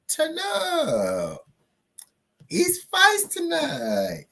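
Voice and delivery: high, slow stretched cry; high drawn-out fight call; hype call. Acted. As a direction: hype the crowd up.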